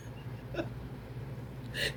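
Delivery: silent, wheezing laugh; breathless real laughter. Real, not acted. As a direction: laugh with barely any voice. Laughing at Nipsey's warning.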